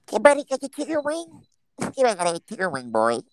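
high, fast squeaky mockery; host teasing the caller in a squeaky voice; mocking high voice. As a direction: tease him in a silly squeaky voice. Mocking voice about wing.

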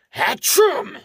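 loud, high comic sneeze; explosive mock sneeze; acted sneeze. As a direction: burst out with a big "achoo". Character sneezes again in story.

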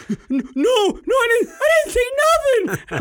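put-on high, loud, slow squeaky kid voice; panicky kid denial. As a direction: plead innocence like a scared boy. Son denies, voiced.

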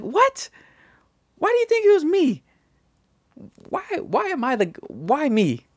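very high, squeaky "what, why me"; shocked denial. Retold or imitated. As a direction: play wounded innocence. Retold denial of blame.

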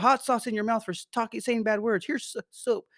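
high, scolding mock parent; hammed-up scolding mom. A parent punishing a child for swearing, mock scolding. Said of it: "parent punishing cussing kid".

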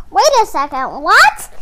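hammed-up disbelief at the book; a startled squawk on the last word; shocked reader.

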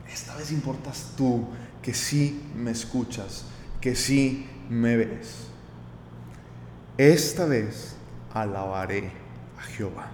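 slow, low and soft, with pauses; tender prayer. Acted. Preacher voices Lea turning to praise God, slow and tender. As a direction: speak it to God, hushed and unhurried.

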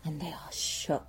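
whispered punchline; hushed shock. Real, not acted. Telling how the news lands on villagers, whispered. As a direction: drop to a stunned whisper.

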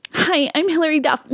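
high, cutesy TV promo greeting. Retold or imitated. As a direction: imitate a teen star trying to be cute. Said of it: "trying to be so cute".

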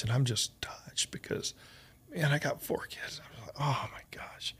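hushed, touched whisper; choked-up whisper. Real, not acted. Host recalling a commercial that made him cry, whispering. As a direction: whisper it as if moved to tears.

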